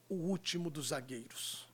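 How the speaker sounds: normal talking voice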